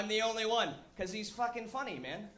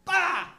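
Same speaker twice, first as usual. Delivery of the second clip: bah outburst; 9 semitones higher, 10 dB louder than usual. Acted. Outburst imagining a punch.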